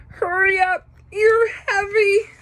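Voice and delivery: high strained cry under the weight; straining shout. A narrator's reading. Snake bridge begging the bear to hurry, straining shout. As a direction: call out, struggling to hold on.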